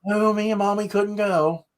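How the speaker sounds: put-on high, loud little girl's complaint; high child voice, upset; imitating daughter. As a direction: mimic a little girl who is upset.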